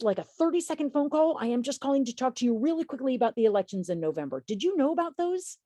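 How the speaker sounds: beam through the phone so she stays on the line; smiling voice. Shown as a demonstration. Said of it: "talking with a smile on your face".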